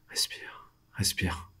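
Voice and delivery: soft whisper to himself; whispered self-calming. Acted. Freezing prisoner calming himself in his cell, soft whisper. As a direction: whisper to steady your own breathing. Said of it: "ne pas faire de bruit".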